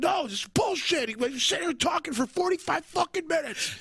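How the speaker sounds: high squeaky complaining voice; mock angry drummer. Friend imitating the drummer griping about the long talking. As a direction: whine it up high like the drummer fuming. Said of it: "And he's like".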